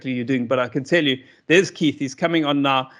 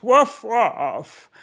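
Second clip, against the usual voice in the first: weary, drawn-out woof, a little higher; exhausted dog; 7 semitones higher than usual. Retold or imitated. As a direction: bark like a dog too tired to bother. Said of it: "They always sound like this".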